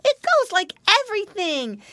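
high, loud boasting piglet; thrilled child voice; Curly Tail. A narrator's reading. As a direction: brag in an excited squeal. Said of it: "cried Curly Tail".

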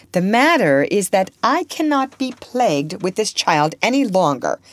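an indignant guardian at the end of her patience; exasperated Miss Ophelia. Acted. Guardian complains about the troublesome child, exasperated.

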